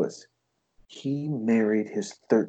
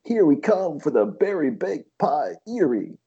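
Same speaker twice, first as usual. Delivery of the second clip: higher-pitched, hyped-up DJ patter; radio DJ; 5 semitones higher than usual. Acted. Comedian mocking a radio DJ's intro, hyped DJ voice. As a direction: punch it out like a cheesy radio DJ. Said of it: "the radio D J comes and he goes".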